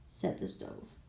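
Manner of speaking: usual speaking voice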